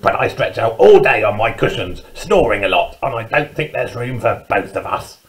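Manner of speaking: gruff, barked badger voice; barking badger. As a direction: bark it like a badger in a picture book. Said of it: "barked Badger".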